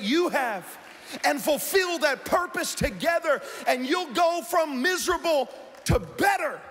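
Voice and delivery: preacher climbing to a shout; rising sermon. Real, not acted. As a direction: drive it home to the congregation.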